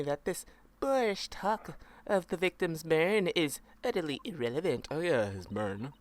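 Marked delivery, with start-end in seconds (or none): none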